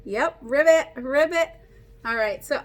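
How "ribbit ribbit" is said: ribbit said like a frog; playful frog noise; frog croak. Shown as a demonstration. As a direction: croak it out for the kids. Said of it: "what sound does a frog make".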